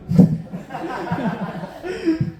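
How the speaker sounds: long, very high laugh; high burst of laughter. Real, not acted. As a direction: crack up at your own punchline. Laughing at own joke.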